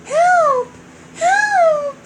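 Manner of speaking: high, loud cries of help; princess crying for help. Acted. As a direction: call out high and desperate.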